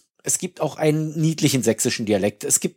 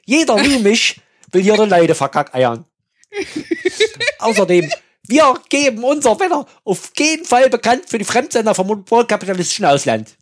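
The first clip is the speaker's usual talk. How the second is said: loud, higher Saxon official ranting; 7 semitones higher, 8 dB louder than usual. Retold or imitated. Quoting a comedy Saxon official, loud and ranting. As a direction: bellow it like a Saxon functionary. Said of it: "Das ist hängen geblieben".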